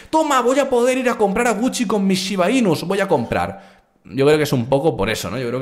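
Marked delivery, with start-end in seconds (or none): 0.1-3.8 high, silly mock voice of a gullible buyer; mocking a naive Shiba Inu holder who thinks he can shop at Gucci, high and silly; mocking a fool. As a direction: ham up a dim crypto holder bragging. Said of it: "hay mucho tonto que las tiene y dice".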